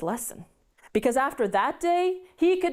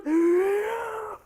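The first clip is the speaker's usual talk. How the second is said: a big, high gasping intake of air; huge gasp; 8 semitones higher than usual. Acted. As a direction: suck in air as if about to burst. John about to explode with breath.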